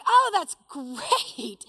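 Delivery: drawn-out, delighted relish on great. Retold or imitated. Daughter-in-law welcoming news of rain, delighted. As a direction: stretch it out happily, savoring it.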